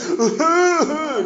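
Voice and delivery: a short high burst of laughter. Real, not acted. Preacher laughing high at the congregation's weeping. Laughs after men wept demo.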